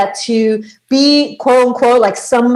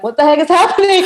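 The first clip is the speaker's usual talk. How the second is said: higher-pitched mock disbelief; eye-rolling disbelief; 7 semitones higher, faster than usual. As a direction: retell it as an eye-rolling sceptic. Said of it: "rolling my eyes to the back".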